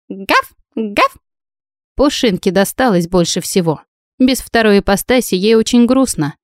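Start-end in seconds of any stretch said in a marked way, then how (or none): 0.1-1.2 lightly voiced little dog yapping; dog barks. Little spitz greeting the heroine, light mock barking. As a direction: bark twice like a small spitz.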